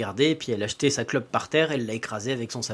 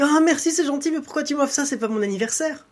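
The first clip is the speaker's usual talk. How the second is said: put-on high, bright girlish voice pitched way up; young girl Anaïs; 13 semitones higher, 3 dB louder than usual. Girl thanking her father for a gift, high girlish voice. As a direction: play the surprised young daughter, voice lifted high.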